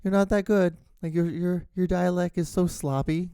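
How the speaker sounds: high, condescending mock-sweet voice; teasing relative. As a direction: talk down sweetly like an amused aunt. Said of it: "it's like".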